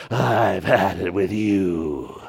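slow, low, weary parent warning; fed-up parent voice. Retold or imitated. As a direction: drag it out like a tired parent who has had enough. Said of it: "On aisle three of Kroger".

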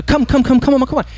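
high, pressing come-ons; eager urging. Acted. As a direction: hurry him along, pitched high. Spirit urging the painter ghost.

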